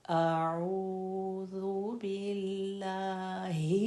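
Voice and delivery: अऊज़ु बिल्लाहि chanted very slowly with clear hamza; slow Quran recitation. Shown as a demonstration. Teacher reciting for students: slow chanted tajweed. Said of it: "तो मैं clear होना चाहिए".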